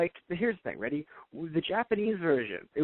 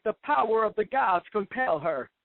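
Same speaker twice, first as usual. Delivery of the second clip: high, very slow, drawn-out proclamation; mock preacher intoning, much higher; hammed-up preacher; 5 semitones higher than usual. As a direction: stretch it out like a mock sermon. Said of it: "an over-exaggerated preacher".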